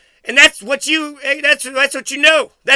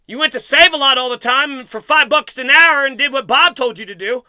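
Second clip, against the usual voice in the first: ordinary delivery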